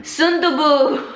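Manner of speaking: high, loud, drawn-out playful blurt; playful wrong guess. Real, not acted. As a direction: sing out the mistaken title, high and long. Playfully blurting a wrong drama title.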